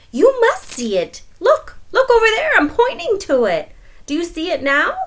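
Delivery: loud, high, insistent demand; angry. Acted. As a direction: push hard, frustrated spider. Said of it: "Anansi began to get angry".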